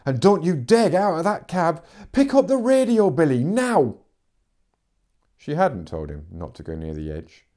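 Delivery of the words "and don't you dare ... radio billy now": much higher and louder, commanding; furious shouted orders. Acted. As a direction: yell the orders down the radio. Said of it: "her shout crackled through the radio".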